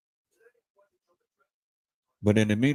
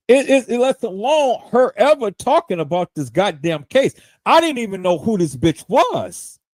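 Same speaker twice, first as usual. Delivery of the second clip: higher, louder heated rant; 9 semitones higher, 6 dB louder than usual. Real, not acted. To his live viewers about a rival streamer, a loud heated rant.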